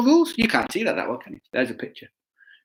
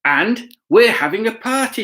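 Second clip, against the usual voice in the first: higher, cheerful called-out command; storybook shout; 11 semitones higher, 3 dB louder than usual. Acted. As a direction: call it out like a joyful preacher. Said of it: "he shouted".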